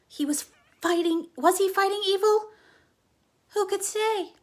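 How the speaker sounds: rising excitement about a hero; dramatic narration. A narrator's reading.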